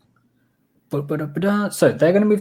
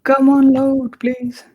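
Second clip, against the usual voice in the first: pleading; 9 semitones higher, 5 dB louder, slower than usual. Real, not acted. Begging the slow map to load.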